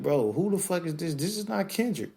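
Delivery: high, disbelieving outcry; a stunned fan's high protest; incredulous. Retold or imitated. Fan reacting to the new album, high and disbelieving. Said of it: "And it's like".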